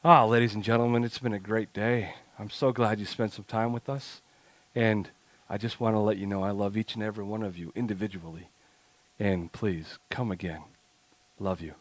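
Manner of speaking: heartfelt podcast goodbye to the audience; heartfelt closing. Shown as a demonstration. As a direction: say goodbye to listeners as if you love each one. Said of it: "here's my first heartfelt".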